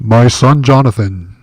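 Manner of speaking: lightly booming, solemn God voice; a hint of a voice from the heavens. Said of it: "We'll use the God voice since he's here".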